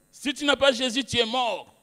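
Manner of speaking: high, loud preacher's shout of doom; condemning shout. Retold or imitated. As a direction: thunder the condemnation at him. (cri de condamnation, aigu et très fort)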